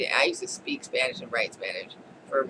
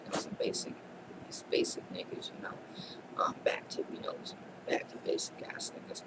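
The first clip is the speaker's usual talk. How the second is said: whispered aside; 9 dB quieter than usual. Real, not acted. Joking aside about daycare name.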